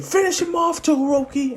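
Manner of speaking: high-pitched mock shout; acted battle order. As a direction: yell to a teammate mid-fight. Hero shouts order to ally.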